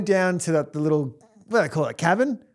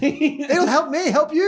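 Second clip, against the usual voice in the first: pleading to partner; 10 semitones higher, slower than usual. Retold or imitated. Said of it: "And I was like".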